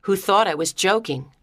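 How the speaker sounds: plain reading voice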